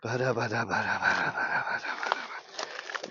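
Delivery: trailing off into a whisper; fading mumble. Real, not acted. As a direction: let it fade to breath. Mumbling while clearing the hive.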